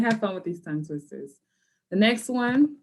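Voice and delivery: ordinary talk, nothing marked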